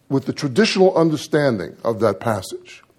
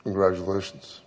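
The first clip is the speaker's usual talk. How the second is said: no enthusiasm; 4 semitones lower, 7 dB quieter than usual. Retold or imitated. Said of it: "Sucking all of the enthusiasm out of the word".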